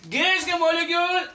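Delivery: loud, high, sustained teacher's call; emphatic calling out. Real, not acted. Teacher announcing gas molecules to the class, loud calling out. Teacher calls out key term loudly.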